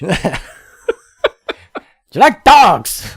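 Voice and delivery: put-on loud, slow film-quote accent; mock Snatch accent. Quoting Snatch to friends, loud put-on accent. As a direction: belt out the movie line in a thick put-on accent.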